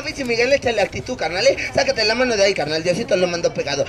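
normal talking voice

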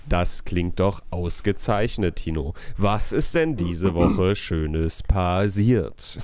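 low, slow radio announcer voice. Pretend. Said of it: "in meine Radiostimme wechseln".

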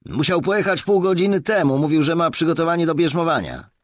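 put-on high, loud young officer; constable informing. A narrator's reading. (wysoki, głośny głos funkcjonariusza)

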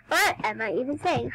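normal talking voice

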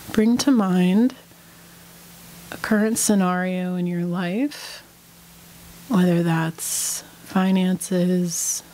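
unhurried meditation guide, long pauses between phrases; guided meditation. Real, not acted. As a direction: lead them calmly through breath and body, leaving space after each phrase.

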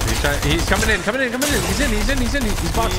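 rising, loud, hyped play-by-play; excited callout. Real, not acted. Excited callout of enemy pushing.

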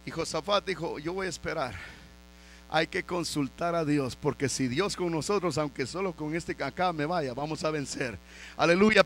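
quiet, intimate sermon passage; hushed preaching. Acted. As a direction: draw them in with a hush. Quiet retelling of Josafat's words.

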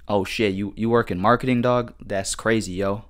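ordinary talk, nothing marked

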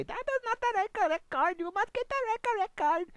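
high, loud put-on guard voice; border guard voice. Border guard stopping Bob's bus, mock official. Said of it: "But they say".

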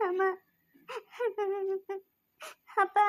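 sobbing call to parents; crying. Acted. Daughter calls to departing parents, crying. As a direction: call out through sobs. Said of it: "ಅಳ್ಬೇಡ್ದಮ್ಮ, ಅಳ್ಬಾರ್ದು".

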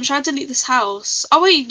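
ordinary delivery

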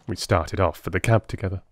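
ordinary delivery, nothing marked

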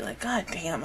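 ordinary talk

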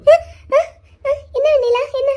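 high, tearful reply. Acted. Elder sister caught crying by the younger one, tearful. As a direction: answer while caught crying. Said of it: "எதுக்குக்கா அழுதுட்டு இருக்கீங்க".

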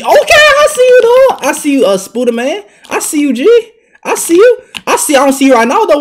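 high, loud calling out; loud, high-pitched trash talk; loud taunting. Real, not acted. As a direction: holler it at the opponent. Trash-talking a player during game.

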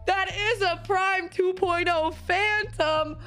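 slow, high awed delight; amazed. Real, not acted. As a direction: savour the name in awe. Wowed by a Prime Phantom.

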